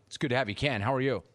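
tape playback of the call; the host's earlier call replayed on air. Real, not acted. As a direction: sounds like a recording played back. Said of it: "Let me play that back".

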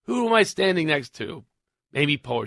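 ordinary delivery

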